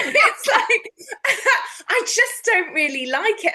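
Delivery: high, loud burst of laughter at her own joke; laughing. Real, not acted. Laughing at her own joke about drinking. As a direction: laugh through the words.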